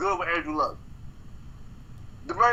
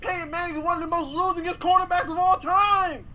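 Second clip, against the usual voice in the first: heated high rant; 10 semitones higher than usual. Real, not acted. Heated defense of Brady.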